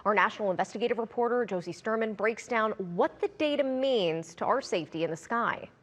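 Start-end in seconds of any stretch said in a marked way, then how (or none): none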